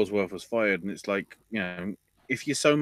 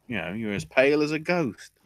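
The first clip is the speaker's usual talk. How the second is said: mock taunt; 7 semitones higher than usual. Ellsworth taunt.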